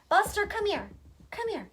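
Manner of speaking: high sweet call to the dog; high coaxing pet call. Real, not acted. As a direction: coax him over, voice lifted high. Calling the crying dog over.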